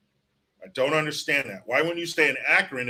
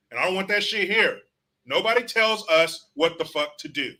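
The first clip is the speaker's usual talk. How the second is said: ordinary delivery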